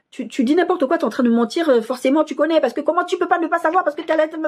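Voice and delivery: high, heated accusation; accusing advisor. Retold or imitated. The advisor on the phone accusing her of lying, high and heated. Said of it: "la façon dont le monsieur me répondait".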